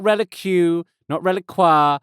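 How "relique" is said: "Relique" said as "Rel-ick-yoo"; wrong pronunciation. Shown as a demonstration. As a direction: say "Relique" with a -kyoo ending.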